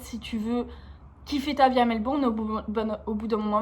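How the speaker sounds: usual speaking voice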